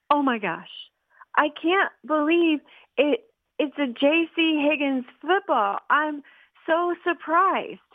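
high, slow, overdone surprise; faked surprise. Boy pretends to be surprised by a gift he already knows, hammed up. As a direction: ham up the amazement a bit too much. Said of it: "Remember to act surprised".

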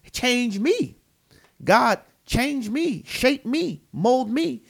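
earnest imitated prayer, pitch lifted; pleading prayer. Acting out a prayer to God.

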